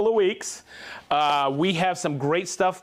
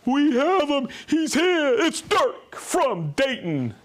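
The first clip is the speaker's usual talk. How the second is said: high, drawn-out hype announcement; playful shouted roll-call introduction; excited announcer call; 8 semitones higher, slower than usual. Pretend. Hyped announcement of a viewer's name.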